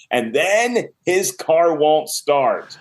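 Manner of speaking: drawn out very slowly for suspense; slow, ominous storytelling emphasis; dramatic reveal. Real, not acted. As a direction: stretch each word for the plot twist. Dramatic telling of the plot twist.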